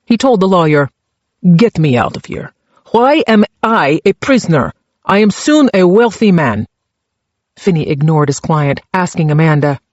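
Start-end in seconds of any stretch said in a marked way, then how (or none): 1.5-6.7 put-on Czech-accented prisoner demanding release; thick Czech accent. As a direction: complain in a heavy Czech accent. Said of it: "his accent so thick".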